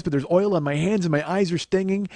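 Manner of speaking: usual speaking voice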